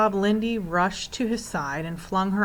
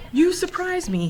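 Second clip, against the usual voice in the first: much higher, voiced in story; surprised remark; 12 semitones higher, 4 dB louder than usual. A narrator's reading. Proc greets the arriving children, surprised. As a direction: lift it high in mild surprise.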